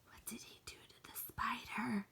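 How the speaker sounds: hushed, horrified whisper. Acted. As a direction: whisper it in disgust. Horrified question after gross scene.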